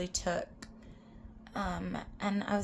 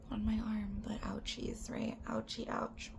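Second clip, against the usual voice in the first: whisper; 7 dB quieter than usual. Real, not acted. Musing about getting grandpa's tattoo, whispered. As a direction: hush it like a small complaint.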